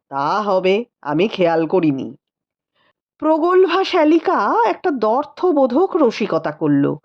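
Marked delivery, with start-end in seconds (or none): 0.1-2.2 a touch lower, weary and teasing; tired rider brushing off questions; lightly voiced tired husband. A narrator's reading. Rupendra answering the sister-in-law, mildly weary. Male character voiced low.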